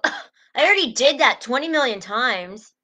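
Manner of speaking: mock exasperation. Coach mocking an impatient parent, mock exasperated. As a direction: play the impatient helper, hammed-up. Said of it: "we don't go".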